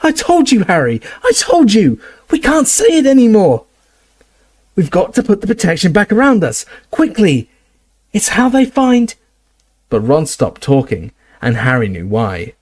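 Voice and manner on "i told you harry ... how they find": high, loud panicked yelling; panicked shouting. Acted. Ron panicking at Harry as the enemy arrives, high shouts. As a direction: yell in alarm, urgent.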